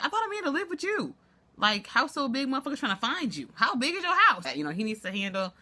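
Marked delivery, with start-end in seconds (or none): 0.0-4.5 high, incredulous retold reaction; mock disbelief. Vlogger retelling her reaction to Fizz, mock baffled and high. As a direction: act baffled, pitch way up.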